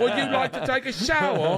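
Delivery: badly faked celebrity voice on purpose. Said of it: "it's gonna be a little off".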